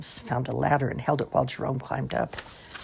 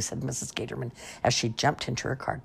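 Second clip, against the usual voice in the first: plain reading voice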